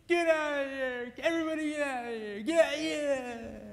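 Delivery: high, hammed-up grouchy yell; grouchy angry husband. Husband grumpily ordering the fawns off his air hose. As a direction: drive everyone off like a grumpy bear. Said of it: "He's grouchy and grumpy and angry".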